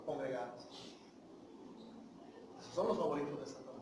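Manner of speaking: ordinary talk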